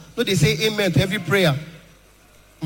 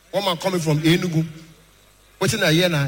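usual speaking voice

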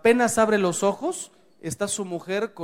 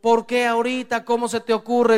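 mock yell, higher and louder; scolding wife, mildly acted out; retold wifely yelling; 5 semitones higher, 5 dB louder than usual. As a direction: imitate an upset wife yelling. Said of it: "Viene tu mujer gritando".

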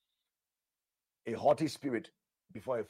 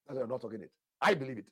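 ordinary talk

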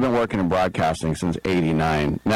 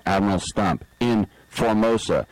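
ordinary talk, nothing marked

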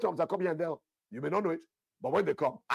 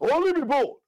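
loud and much higher burst; shouted exhortation; 12 dB louder than usual. Acted. Pastor rouses the congregation with a sudden high shout. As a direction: shout it out to stir them. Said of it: "exhorting congregation".

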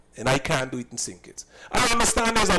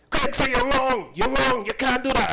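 higher, indignant mock scolding; mock scolding customer; 8 semitones higher than usual. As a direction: parrot her scolding in a high put-on voice. Retold quarrel, the woman scolding him.

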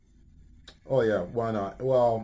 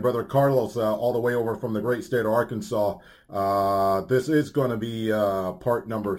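radio announcer; 3 semitones higher, 5 dB louder than usual. Acted.

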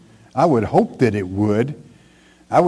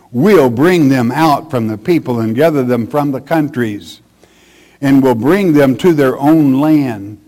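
louder, emphatic preacher's Bible reading; forceful scripture reading; 4 dB louder than usual. Acted. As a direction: proclaim the verse from the pulpit. Reads scripture aloud forcefully.

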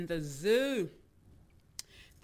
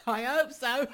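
higher voice bubbling with laughter; laughing; 10 semitones higher, 4 dB louder than usual. Real, not acted. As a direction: laugh through the words. Host laughs at own hopeful reply.

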